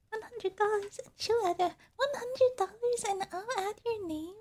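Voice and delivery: put-on high, sugary anime-girl streamer voice; put-on cutesy thank-you. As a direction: stretch it out high and singsong like a cutesy idol. Acting a cute streamer reading donations.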